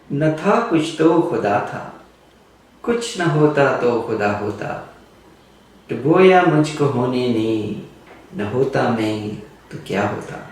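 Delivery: fast, rhythmic recital of an Urdu couplet; reciting Urdu poetry. Retold or imitated. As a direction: rattle off Ghalib's verse quickly in Urdu. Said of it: "Mirza Ghalib ... writes".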